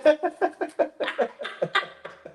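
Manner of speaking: light high laugh, trailing off; a real amused laugh, high up; bright falling laugh. Real, not acted. Laughs after the story.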